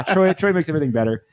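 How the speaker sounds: normal talking voice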